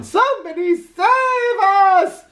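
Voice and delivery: very high, slow panicked shriek; shrieking for help. A narrator's reading. Dogs shrieking at the monster, high and panicked. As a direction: cry out in panic. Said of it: "shrieked the dogs".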